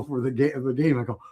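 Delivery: ordinary delivery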